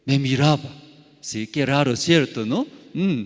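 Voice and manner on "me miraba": ordinary delivery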